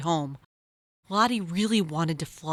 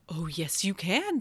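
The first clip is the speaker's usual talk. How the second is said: reassuring mom; 4 dB quieter than usual. Acted. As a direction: gently comfort a scared child. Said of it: "said Lottie's mom".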